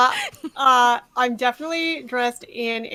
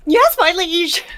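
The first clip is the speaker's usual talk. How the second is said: higher, louder servile cry; eager yell; 5 semitones higher, 4 dB louder than usual. Acted. Sploot to their liege on waking, eager yell. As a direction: yell it out like a devoted servant. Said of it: "and just yelling".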